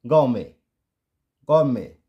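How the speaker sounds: "गांव में" as gour-MAY, no T; silent final T. Shown as a demonstration. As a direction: end "गांव में" on an ay vowel. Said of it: "silent हो गया". ("गांव में" यानी गॉरमे, टी साइलेंट)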